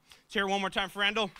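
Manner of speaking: quick repeat call. Real, not acted. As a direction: rattle it off quickly.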